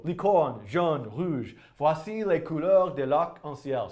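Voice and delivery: put-on slow, halting amateur French accent; terrible French accent. Live streamer reads a translated poem to viewers, terrible French accent. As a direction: read it out in bad French, word by word. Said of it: "with my terrible French accent".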